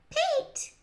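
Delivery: very high, short squeaky yell; high-pitched small-child outburst; little boy's yell. A narrator's reading. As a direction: pipe it up like a tiny kid. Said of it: "yelled Mikey".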